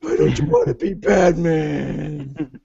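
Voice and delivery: slow booming metallic robot; slow deadpan robot villain. Pretend. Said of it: "What's wrong with my robot voice?".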